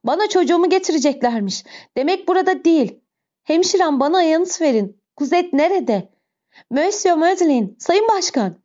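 high-pitched, desperate mother's plea; agitated, anguished cries, pitched high throughout; frantic crying out. A narrator's reading. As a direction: beg wildly for news of your child. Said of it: "diye haykırdı".

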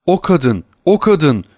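rising panic on the repeat; shocked cry. Acted. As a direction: servant recognising the woman, cry swelling. Servant shaken at sight of woman.

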